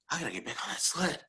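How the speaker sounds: fast, hushed urging to himself; urgent self-talk. Retold or imitated. Luger psyching himself up for the sled, urgent.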